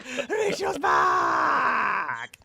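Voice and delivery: high, very slow puppet yell; drawn-out puppet shout. Acted. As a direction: stretch every syllable and yell it out.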